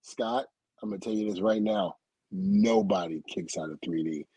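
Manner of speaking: low, quiet warning; dead serious. Retold or imitated. Partner to a wrestler doubting their finisher, dead serious. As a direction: set him straight, soft and stern. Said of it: "looked at him with a serious face".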